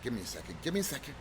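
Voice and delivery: ordinary talk, nothing marked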